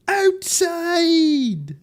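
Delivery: high, drawn-out wail; mock wailing cry. Answering where to sleep in a comic bit, mock wail. Punchline of a quoted movie bit.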